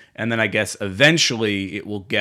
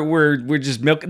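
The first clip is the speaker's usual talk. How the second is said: ordinary talk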